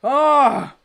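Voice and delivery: frustrated yell. Real, not acted. Frustrated fan outburst.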